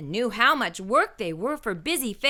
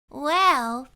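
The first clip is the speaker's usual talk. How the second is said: put-on child voice; 7 semitones higher, 3 dB louder than usual. A narrator's reading. Mona speaking in story.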